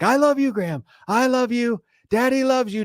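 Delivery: high, hammed-up doting father; mock gushing dad. Dad retelling his own yell, high and gushing. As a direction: gush it out like the embarrassing dad again. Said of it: "And I'm like".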